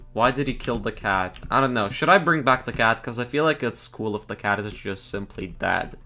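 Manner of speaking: normal talking voice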